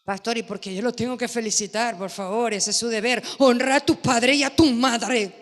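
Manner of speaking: objeción rápida, imitando a un padre; padre que objeta. Retold or imitated. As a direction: protesta de padre incrédulo. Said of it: "Qué hacen allá atrás?". (un padre le replica al pastor, levemente imitado)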